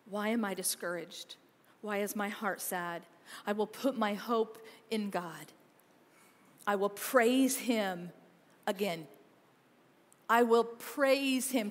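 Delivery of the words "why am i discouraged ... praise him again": soft scripture reading. Acted. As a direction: read it gently, letting each line sink in. Rereads the psalm.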